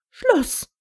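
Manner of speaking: laut und viel höher, ein Machtwort; scharf unterbrochen. A narrator's reading. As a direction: den Streit mit einem Wort beenden. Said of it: "unterbrach McGonagall den Streit". (McGonagall beendet den Streit mit scharfem Ruf)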